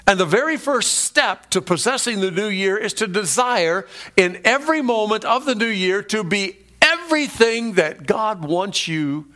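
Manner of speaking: ordinary talk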